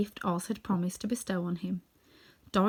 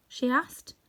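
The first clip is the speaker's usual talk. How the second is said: usual speaking voice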